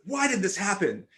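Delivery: high, loud accusing yell. Retold or imitated. Speaker yelling at the robed man in the void, loud. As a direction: throw the question at him in anger. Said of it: "yelling at him".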